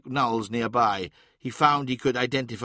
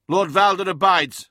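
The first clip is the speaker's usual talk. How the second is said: higher, louder formal reply; solemn declaration; 6 semitones higher, 7 dB louder than usual. Acted. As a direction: state it with guarded pride. Custodes guard answers Magnus.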